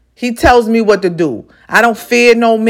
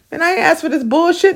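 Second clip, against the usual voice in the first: higher-pitched mock protest; acted complaint; 7 semitones higher than usual. Herself protesting to the universe, mock complaint. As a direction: complain up high, playing it for effect. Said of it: "And I be like".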